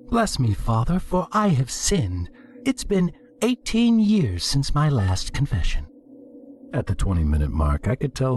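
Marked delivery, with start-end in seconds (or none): 0.1-5.9 high, sing-song mock piety; playful confession. A narrator's reading. Penitent having some fun with the priest, mock-pious.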